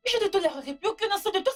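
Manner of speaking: fast, high-pitched angry outburst; indignant and quick; heated quarrel. Acted. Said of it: "Pourquoi vous criez toutes les deux".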